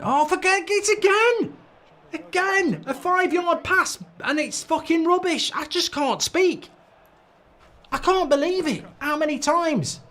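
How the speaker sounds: high, strained cries of disbelief at the pass; exasperated yell. Real, not acted. Fan wailing at his team's misplaced pass, exasperated and high. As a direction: throw your hands up and wail at the screen.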